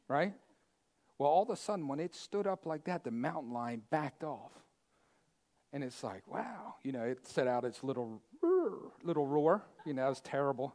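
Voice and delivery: ordinary talk, nothing marked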